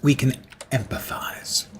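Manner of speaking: hushed, voiceless whisper. Pretend. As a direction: whisper it like a confession. Joking aside to internet people.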